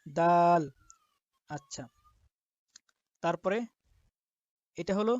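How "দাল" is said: দাল said loud and clear as a model; loud demo of দাল; clear letter demo. Shown as a demonstration. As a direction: announce দাল to the class. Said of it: "Bangla তার উচ্চারণ দ আকার দায়ের মতো".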